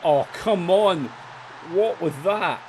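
real frustration at the team; exasperated. Real, not acted. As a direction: protest at the team. Angry at team's play.

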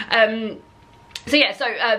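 ordinary delivery

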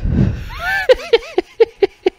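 long high-pitched cackle that falls away; high shrieking laugh. Real, not acted. Cackling at the Tourette's guy clip.

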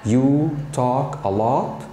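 slow, stress on "You", rising; slow careful model. Shown as a demonstration. Teacher modelling slowly for students. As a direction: spell it out slowly. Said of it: "Another one".

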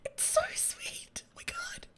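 high, breaking whisper close to tears; tearful whisper. Real, not acted. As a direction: whisper it choked up with happy tears. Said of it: "I'll actually cry".

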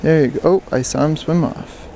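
usual speaking voice

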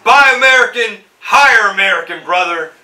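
loud, high wrestler-promo holler; big hyped-up catchphrase yell; Hulk Hogan. Acted. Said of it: "like that boy Hulk Hogan said".

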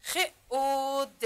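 خ، او، د as three sounds, او drawn out; slow letter-by-letter خ، او، د; sounding out. Shown as a demonstration. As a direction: hold او long between خ and د. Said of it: "اگر این را صدا کشی کنیم".

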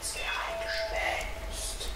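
slow, hushed ghost-story whisper; eerie whisper. A narrator's reading. Narrator tells of the sexton playing ghost, slow eerie whisper. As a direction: stretch it out in a spooky whisper.

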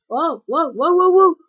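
loud, high alarmed whoas; loud shocked yelps at the game. Real, not acted. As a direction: yelp in shock at the crash. Sudden crash in game.

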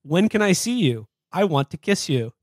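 ordinary talk

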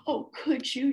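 high, hopeful question; a wishful lift in the voice. A narrator's reading. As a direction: ask with dawning hope. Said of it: "she asked them hopefully".

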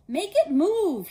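loud, high, slow whine; spoiled boy whining at his father; whined. A narrator's reading. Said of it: "he whined to his father".

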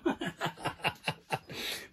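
soft, unvoiced laugh fading out; breathy laugh. Real, not acted. Host laughing to herself under the table, soft. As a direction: let the laugh die away.

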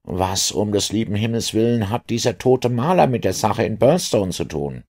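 high-pitched, puzzled inspector voice; bewildered inspector. Acted. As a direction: protest that you cannot follow, voice pitched high. Inspector voiced, losing the thread. (verwirrter Inspektor mit hoher Stimme)

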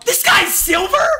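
high-pitched incredulous outburst; shouted disbelief. Real, not acted. Streamer reacting to a player's rank, shouted disbelief. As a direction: blurt it out high, in loud disbelief.

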